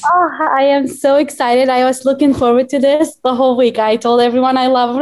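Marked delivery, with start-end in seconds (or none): none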